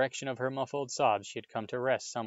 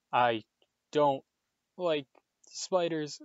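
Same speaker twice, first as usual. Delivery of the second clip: tense, higher voice, word by word; halting admission, a pause between words; 4 semitones higher, 4 dB louder, slower than usual. A narrator's reading. Ron admitting a fear to his friends, tensely. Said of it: "said Ron tensely".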